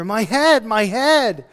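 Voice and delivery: loud, high acted cry of pain; hammed-up child's complaint. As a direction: cry out as if hurting. Acts boy crying out in pain.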